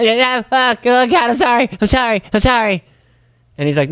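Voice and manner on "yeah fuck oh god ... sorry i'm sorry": high, frantic rush of sorries; flustered apology. Retold or imitated. Angler to fishermen after tangling lines, high frantic apology. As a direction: panic and apologize over and over.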